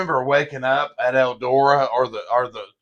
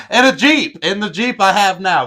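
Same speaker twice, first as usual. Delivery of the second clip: laughing; 8 semitones higher, 7 dB louder than usual. Real, not acted. Laughing about sleeping in Jeep.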